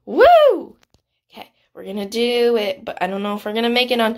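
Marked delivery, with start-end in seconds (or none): 0.1-0.8 short, high, loud woo; excited whoop. Real, not acted. Player psyching up for the round, loud whoop. Cheering herself on.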